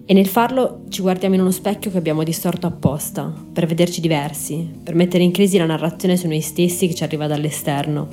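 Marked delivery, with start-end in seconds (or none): none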